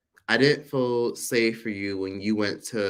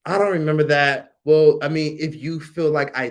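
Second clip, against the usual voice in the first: mocking gaslighting relative; 4 semitones higher, 7 dB louder than usual. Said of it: "So it's a lot of".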